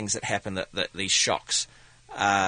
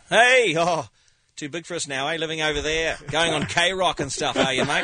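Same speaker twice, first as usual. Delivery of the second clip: imitating a cheeky Kiwi fan, high and loud; 4 semitones higher, 4 dB louder than usual. Comedian mimicking a fan back home, cheeky Kiwi accent. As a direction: tease like a mate from back home.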